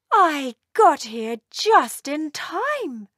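a relieved mother swooping in; mother dragon scolding the runaway elf, slow and loud. Acted. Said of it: "she said".

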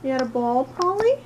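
pet talk. Real, not acted. Owner coos up at her cat about its ball, high and sing-song. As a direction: coo it up at the cat like a delighted owner.

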